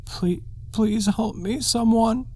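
very high, slow pleading; begging. A narrator's reading. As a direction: beg for help in fear. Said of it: "A note of begging in her voice".

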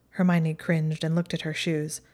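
plain reading voice

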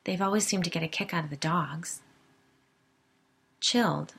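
ordinary delivery

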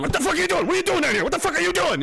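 finding the uncle at the table with a gun, a shrill panicked yell; shocked yell. Retold or imitated. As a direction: yell it as if you just found him with a gun.

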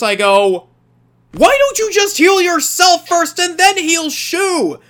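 ordinary talk

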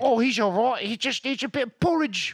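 high, chirpy mock English accent; high mock English accent, breezy. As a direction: cheerfully dismiss it like a Brit. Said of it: "Things are different in England".

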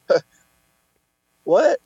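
high, rising "what?"; pause, then a high-pitched puzzled question; mock bewildered reaction. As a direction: imitate a baffled cashier doing a double take. Woman's startled reaction to his order.

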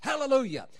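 ordinary delivery

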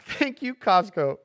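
hammed-up relieved mother thanking Costco; relieved tired parent. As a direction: gush thanks like a worn-out mom. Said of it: "a hot dog is like".

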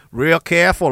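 trying an Aussie twang; half-working Australian imitation; attempted Australian accent. Comedian mimicking Australian soldiers, attempted Australian accent. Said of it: "I used to be able to do it".

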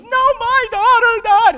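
hammed-up high, loud, drawn-out wailing; anguished funeral wail. Mourner shaking the casket at a funeral, acted loud wail. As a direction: wail it out like a grief-stricken mourner. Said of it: "been to one of those funerals".

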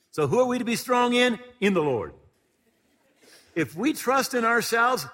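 loud, high fervent preaching; full-force preacher's delivery. Acted. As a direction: drive the scripture home to the congregation.

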